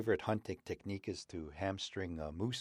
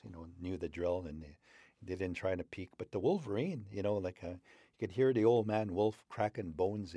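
usual speaking voice

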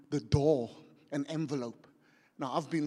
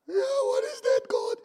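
high-pitched weepy wail; mock crying; 5 dB louder than usual. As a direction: whine it through pretend sobs. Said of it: "I started to cry".

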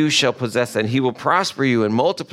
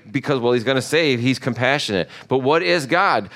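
ordinary talk, nothing marked

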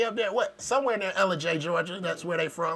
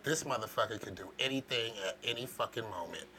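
low, hushed suspicious aside; a hushed, paranoid warning to yourself; low wary mutter; 10 semitones lower, 14 dB quieter than usual. Retold or imitated. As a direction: keep your eye on him, under your breath. Imagined wary inner voice.

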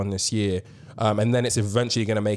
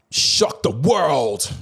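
slow, drawn-out headline voice; dramatic announcement; 3 semitones higher, much slower than usual. Acted. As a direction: say it like breaking news. Dramatic news announcement build-up.

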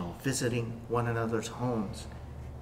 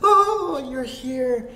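high, loud, tearful cry of joy; weeping Mary; 8 semitones higher, 6 dB louder than usual. Acted. Mary at Jesus' feet, crying out in tearful joy. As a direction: break into a tearful cry as Mary finds him. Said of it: "weeping and crying".

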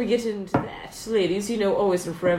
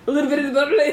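Chris Jericho impression; 5 semitones higher, 4 dB louder, much faster than usual. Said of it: "Chris Jericho going".

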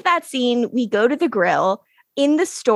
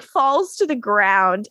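usual speaking voice

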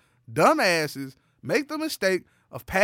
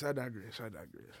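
each repeat softer, fading to nothing; 7 semitones lower, 16 dB quieter than usual. Pretend. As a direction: drift away as you repeat it. Repeats phrase while drifting away.

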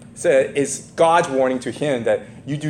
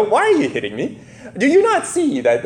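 high-pitched comic donkey complaint; raised, hammed-up voice close to laughing; talking donkey; 9 semitones higher than usual. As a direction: protest as a wronged animal, playfully. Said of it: "what tone the donkey used".

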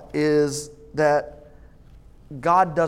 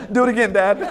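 put-on laughing kid quote; 5 semitones higher, 5 dB louder, faster than usual. Excited kid in joke, laughing.

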